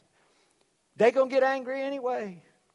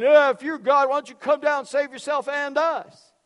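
loud, high-pitched mocking jeer; taunting thief; 6 semitones higher, 6 dB louder than usual. Thief on the cross goading Jesus, loud taunting voice. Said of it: "one thief started going".

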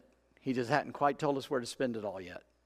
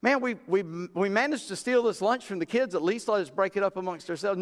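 disciples protesting; 6 semitones higher than usual. Retold or imitated. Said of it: "disciples protest to Jesus".

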